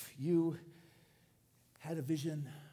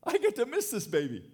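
a touch of cheeky relief, rising higher and louder; gleeful relief; 4 semitones higher, 4 dB louder than usual. Acted. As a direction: play someone lightly glad to be let off. Acts out a relieved eighty-five-year-old.

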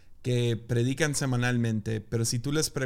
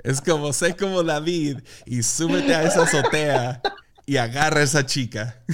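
laughing loudly through words; cracking up while talking; 3 semitones higher, 4 dB louder than usual. Real, not acted. Laughing at mock sermon.

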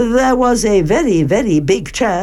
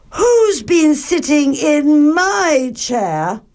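high, slow, indignant mother bear; 7 semitones higher, slower than usual. A narrator's reading. Mother Bear at her chair, high-pitched dismay. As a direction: stretch the question out high. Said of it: "Then Mother Bear looked".

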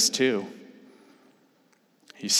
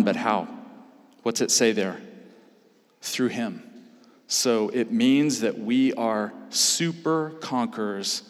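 normal talking voice

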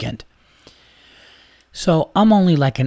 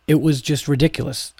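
ordinary delivery

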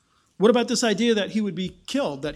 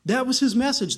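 ordinary talk, nothing marked

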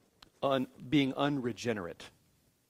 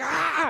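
high, loud cry of frustration; sudden high mock yell; acted-out mad yell; 6 dB louder than usual. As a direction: let out a frustrated "ah" while retelling. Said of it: "I slap the wall 'cause I'm mad".

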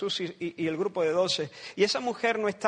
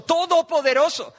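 higher, louder ringing repeat; emphatic proclamation; 8 semitones higher, 5 dB louder than usual. Acted. Preacher proclaiming to the congregation, ringing and loud. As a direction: lift the word like a shout of praise.

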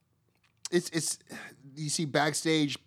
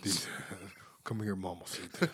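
low teasing voice; 7 semitones lower, 12 dB quieter than usual. Pretend.

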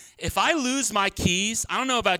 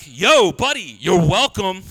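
louder, pointed sarcastic jab; sarcastic call-out; 8 dB louder than usual. Retold or imitated. Pastor voices Wawa customer ignored at the door, louder sarcastic call. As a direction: call it after a rude stranger, miffed. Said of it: "you're like".

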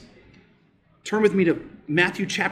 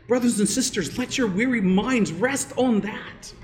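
gentle aside; 6 semitones higher than usual. Acted. Pastor softening to congregation.

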